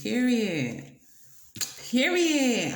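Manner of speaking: sassy emphatic period. Real, not acted. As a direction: slam it home, period. Emphatic agreement, rising.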